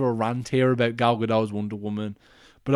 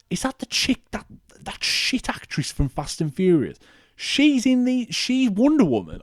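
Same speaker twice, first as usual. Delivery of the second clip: retold disbelief; 8 semitones higher than usual. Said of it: "and I was like".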